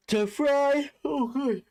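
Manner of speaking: to Fry stretched high on a big yawn; yawning through words. Real, not acted. Commentating on a sleepy Sunday, words yawned out. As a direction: talk through a real yawn. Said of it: "Sorry for the yawning".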